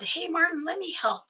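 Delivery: high, gentle coaxing; coaxing plea. Retold or imitated. Sister coaxing her brother-in-law, pitched high. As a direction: sweetly ask to be let in, pitched high.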